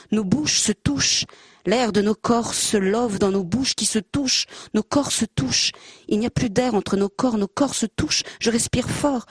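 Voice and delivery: measured, rhythmic recital with repeated refrains; poetry reading. Acted. Reading a Laurence Vielle poem aloud to the audience, rhythmic recital. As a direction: let each refrain ring like a poem read to a hall.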